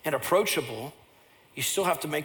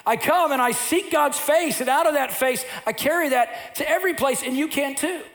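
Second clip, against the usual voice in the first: high, loud passionate preaching; full-force preaching; 9 semitones higher, 3 dB louder than usual. Acted. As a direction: preach it out to the whole room.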